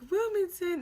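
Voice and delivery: high-pitched, questioning repeat; surprised query, voice jumping up; incredulous echo. Retold or imitated. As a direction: toss the name back in disbelief. Retold surprised reply to suggestion.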